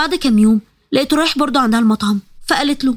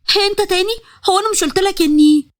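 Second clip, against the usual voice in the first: higher, irritated cry; oh, you again; annoyed outburst; 6 semitones higher than usual. A narrator's reading. Taqa annoyed seeing Iyad at the clinic, higher sharp outburst. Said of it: "اتعصبت قوي".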